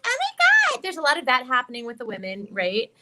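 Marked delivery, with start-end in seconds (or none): 0.1-0.8 put-on very high squeaky girl voice; high cute anime girl. Shown as a demonstration. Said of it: "there's a lot of high".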